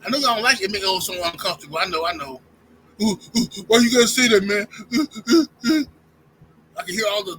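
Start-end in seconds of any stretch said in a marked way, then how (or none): 3.0-4.7 loud mocking ooh from the crowd; mock audience groan. 4.9-5.9 loud hooting ooh ooh; mock crowd oohs. Mock monkey noises from fans.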